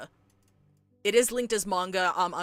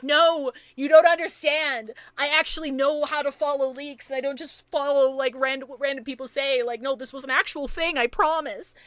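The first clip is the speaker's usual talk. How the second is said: higher, louder defensive insistence; pleading hard that it's real; heated retort; 5 semitones higher, 4 dB louder than usual. Retold or imitated. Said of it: "I'm like".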